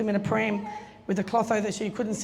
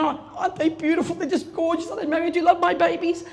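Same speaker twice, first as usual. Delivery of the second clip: higher, bubbly mock-doting gush; playfully doting, higher and bright; 6 semitones higher than usual. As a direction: coo over the babies like a proud mother. Mum showing off the twins.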